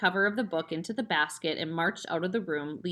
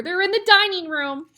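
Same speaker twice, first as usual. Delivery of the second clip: mock frantic hiss; 11 semitones higher, 9 dB louder than usual. A narrator's reading. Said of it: "hissed the mother".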